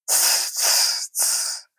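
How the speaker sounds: soft unvoiced tss hiss; upset hissing. A narrator's reading. Upset Gollum hissing as he thinks. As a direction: hiss anxiously like a snake. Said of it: "said Gollum, more upset".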